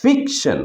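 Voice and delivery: Fiction with ফিক high, then falling; teacher's demo of fiction, weight on ফিক; stress on ফিক. Shown as a demonstration. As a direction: hit the first syllable of fiction. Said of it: "তাহলে এটার ফুল উচ্চারণ হবে".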